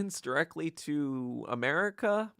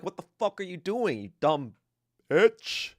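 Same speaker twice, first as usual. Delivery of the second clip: retold Stalin outburst; 6 semitones higher, 4 dB louder than usual. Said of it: "And Stalin was like".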